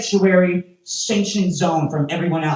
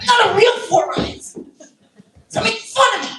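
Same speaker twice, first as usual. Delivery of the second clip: hammed-up high squeal of protest; indignant mock retort. Comic daring the teasers, squealed mock outrage. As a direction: throw it back way up high. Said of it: "that's how you counter".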